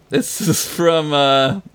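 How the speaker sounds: slow, drawn-out mock announcer. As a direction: stretch out the name like a presenter. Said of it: "do I gotta do a voice for this".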